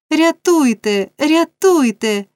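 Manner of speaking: high, drawn-out desperate cry; crying for help. A narrator's reading. Oliver struggling free, crying for rescue. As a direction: call out high, long falling vowels. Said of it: "кричав Олівер".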